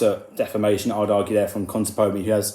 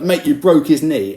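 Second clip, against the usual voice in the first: aggressive tone; 4 semitones higher, 7 dB louder than usual. Retold or imitated. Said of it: "that sort of aggressive tone".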